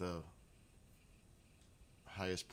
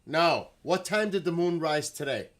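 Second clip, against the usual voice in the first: loud, high, irritated correction; annoyed shout; 9 semitones higher, 13 dB louder than usual. Real, not acted. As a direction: snap back at the device. Annoyed retort to Alexa.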